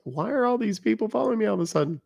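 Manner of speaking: high-pitched mock puzzled wife; lightly mocked high voice of a bewildered wife; imitating wife. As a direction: ask it high, as a baffled wife would. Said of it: "She's like".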